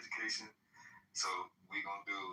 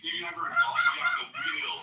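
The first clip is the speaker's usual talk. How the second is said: yelling much higher and louder; angry shout; 4 dB louder than usual. Real, not acted. Ranting streamer yells at the people he attacks, angry shout. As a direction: bark it out, furious.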